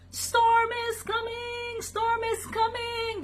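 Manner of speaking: high, drawn-out sheep cry; bleated alarm. A narrator's reading. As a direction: bleat the warning slowly and very high. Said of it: "the sheep bleated".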